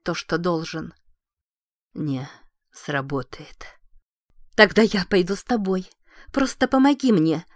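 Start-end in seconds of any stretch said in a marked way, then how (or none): 1.9-3.7 weak dying voice. Acted. Dying husband answers weakly. 4.6-7.4 desperate plea. Acted. Wife pleads desperately.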